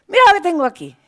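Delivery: loud, high scornful show-off; mocking teacher voice; sneering teacher. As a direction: jeer as you show it off.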